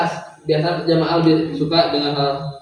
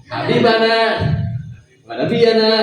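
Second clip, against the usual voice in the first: higher intonation demo; 5 semitones higher than usual. Shown as a demonstration. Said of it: "Jadi ingat saya itu".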